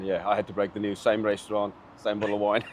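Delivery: ordinary talk, nothing marked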